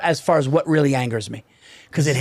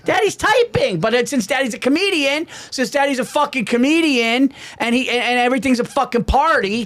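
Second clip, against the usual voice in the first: exasperated rant; 7 semitones higher than usual. Real, not acted. Ranting, exasperated.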